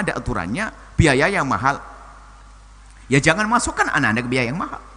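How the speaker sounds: usual speaking voice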